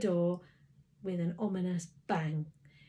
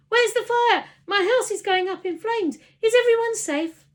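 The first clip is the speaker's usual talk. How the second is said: high, loud panicked cries; frantic mum yelling about a fire; frantic shouting; 14 semitones higher, 13 dB louder than usual. A narrator's reading. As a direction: shout it in a panic over the sirens. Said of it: "making even more noise than the fire sirens".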